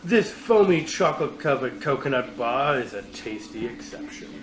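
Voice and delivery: big booming movie trailer read; TV announcer voice. Acted. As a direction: sell it like a TV ad announcer. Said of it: "I think I'm just doing the T V announcer voice".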